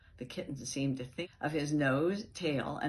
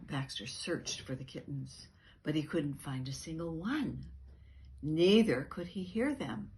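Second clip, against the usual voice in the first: ordinary delivery, nothing marked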